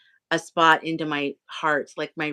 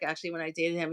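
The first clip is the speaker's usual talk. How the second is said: ordinary talk, nothing marked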